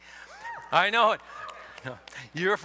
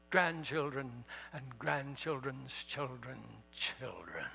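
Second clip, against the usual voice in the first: much lower, mock-solemn booming orator; a hammed-up lower preacher's tone; stentorian; 6 semitones lower, 11 dB quieter, slower than usual. Shown as a demonstration. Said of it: "I said that with as much stentoriousness as I can muster".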